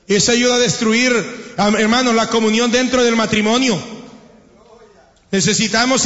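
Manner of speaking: ordinary talk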